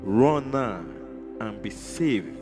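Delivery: ordinary talk, nothing marked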